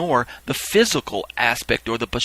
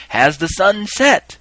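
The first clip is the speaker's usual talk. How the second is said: slow, higher, louder called question; calling out; 7 semitones higher, 6 dB louder, slower than usual. Retold or imitated. Reaper asking the gathered people, calling out. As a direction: call out the question, pitch rising. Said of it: "cry out again".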